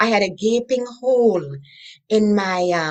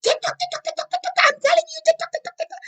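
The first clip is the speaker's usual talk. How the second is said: much higher, shrieking tick tock; screaming clock; much faster than usual. Retold or imitated. Biological clock screaming at her, high shrieking. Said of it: "and it was screaming at me".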